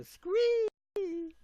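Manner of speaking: high, loud hammed-up squee; mock fan squeal. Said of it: "go to Mara Wilson and go".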